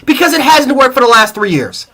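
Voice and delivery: ordinary talk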